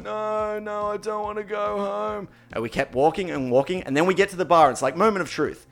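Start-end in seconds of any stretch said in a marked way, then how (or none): 0.0-2.3 mock whiny higher voice, slow; whiny friend refusing. S0 mimics his friend refusing to go home, in a whiny voice. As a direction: protest like a sulky overfed friend. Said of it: "Do you wanna go home?".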